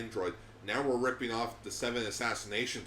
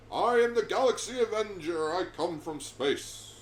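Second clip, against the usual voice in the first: hero announcer voice; 7 semitones higher, 5 dB louder than usual. Pretend.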